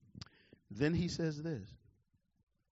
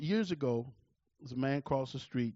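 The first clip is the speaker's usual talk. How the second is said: ordinary talk, nothing marked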